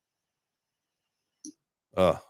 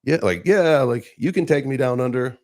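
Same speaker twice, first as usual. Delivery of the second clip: higher, lightly raised calling voice; mock holler, pitched up; retold yell; 6 semitones higher than usual. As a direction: imitate someone yelling up the stairs. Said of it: "And she yells up".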